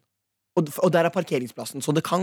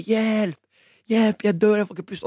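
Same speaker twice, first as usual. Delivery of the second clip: high mock yell of panic, pitched up; retold screaming for help; 7 semitones higher, slower than usual. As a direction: replay the child's cry for help, higher and urgent. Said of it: "drev jeg og skreik".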